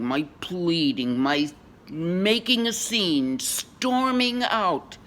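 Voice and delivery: rising to a high, loud peak; theatrical crescendo of a lover's outburst; building melodrama. Acted. Dramatic recounting of breakup scene.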